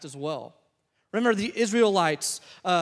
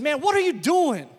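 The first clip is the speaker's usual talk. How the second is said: raised, higher-pitched challenge; confronting brother; 8 semitones higher, 6 dB louder than usual. Retold or imitated. Brother pulls moping player aside, confronting him. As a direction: call him out, loud and high.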